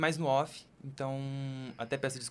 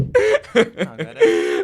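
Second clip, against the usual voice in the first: much higher, laughing protest; 10 semitones higher, 18 dB louder, much slower than usual. Real, not acted. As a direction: squeal it out mid-laugh. Ri da piada da apresentação. (protestando aos risos, bem agudo)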